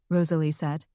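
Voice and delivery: ordinary delivery, nothing marked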